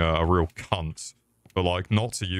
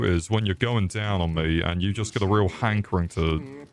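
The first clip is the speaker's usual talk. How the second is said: ordinary talk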